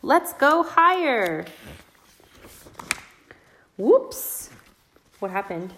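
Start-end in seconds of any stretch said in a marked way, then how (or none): 0.0-4.5 excited. Acted. Swing scene read aloud.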